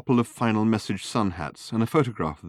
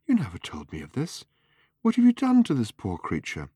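hissed accusation, rising high at the end; sharp reproach; 5 semitones higher than usual. A narrator's reading. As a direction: take them to task sharply. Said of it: "hissed Fenchurch in his ear sharply".

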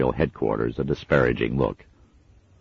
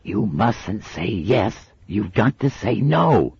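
hissed urging; 8 semitones higher, slower than usual. A narrator's reading. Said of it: "hissed the head torturer".

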